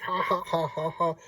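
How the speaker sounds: spelled-out possum cackle; mock villain laugh. Possum gloats over his scheme, mock villain laugh. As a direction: fake a gloating ha-ha, beat by beat.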